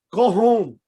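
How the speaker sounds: "Go home" barked loud and falling; angry command. Real, not acted. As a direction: order him out, hard. Ranting fan telling Vince off.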